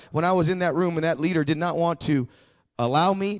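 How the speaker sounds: ordinary talk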